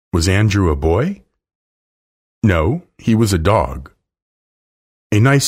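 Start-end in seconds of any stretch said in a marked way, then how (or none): none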